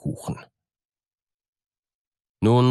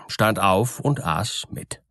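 ordinary delivery, nothing marked

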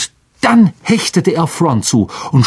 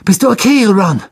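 high call through rubble; 10 semitones higher, 4 dB louder than usual. A narrator's reading. Harry calling over the rockfall: anxious call. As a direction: call to a friend out of sight. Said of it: "rief er".

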